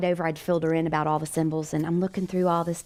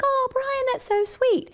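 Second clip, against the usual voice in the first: very high, cooing delight; retold girlish gush; gushing thanks; slower than usual. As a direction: coo it as if touched by a gift. Touched thanks for the ring, retold.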